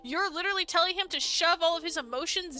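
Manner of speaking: furious streamer yelling at a game character; real outrage. Real, not acted. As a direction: scold her like she can hear you. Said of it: "I'm getting so mad".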